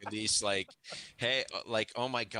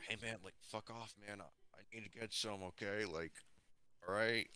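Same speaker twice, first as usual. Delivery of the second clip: hushed, much softer and a touch lower; confidential aside; 4 semitones lower, 12 dB quieter than usual. Acted. Player pulls Firebird aside to ask for space, hushed. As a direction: keep it under your breath, just between you two. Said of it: "pull the Firebird to the side and it's like".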